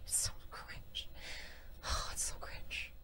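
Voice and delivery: slow, soft unvoiced whisper; whispered disgust. Real, not acted. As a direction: let it sink out in weary whispers.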